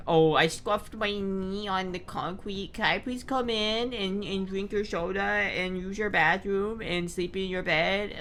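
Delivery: a hint of a simpering beggar; whiny visitor. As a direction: lightly whine it like a pushy guest begging. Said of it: "if they're like".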